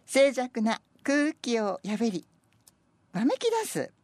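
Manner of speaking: slow, measured poem reading; poem recitation. Acted. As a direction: let each phrase land slowly. Reads senryu aloud.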